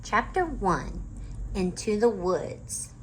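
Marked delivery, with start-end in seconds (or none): none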